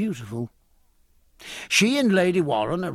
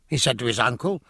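ordinary delivery, nothing marked

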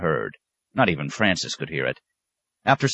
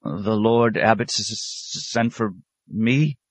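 slow, stammering question, higher in pitch; nervous novice catching on the S; squawked; 4 semitones higher, slower than usual. A narrator's reading. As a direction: stretch it out in timid disbelief, rising at the end. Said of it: "squawked the novice".

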